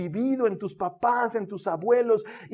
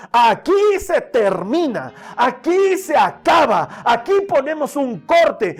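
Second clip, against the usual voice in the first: preacher shouting the king's vow to the congregation; resolute vow; 4 semitones higher, 6 dB louder than usual. Acted. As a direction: proclaim it like a king breaking the family curse. Josiah's vow preached at full force.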